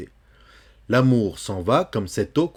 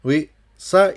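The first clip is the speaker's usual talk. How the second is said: normal talking voice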